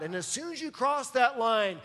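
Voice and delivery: ordinary talk, nothing marked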